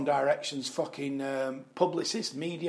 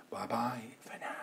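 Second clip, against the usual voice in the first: whispered goodbye; 4 semitones lower, 9 dB quieter than usual. Real, not acted. Signing off the show.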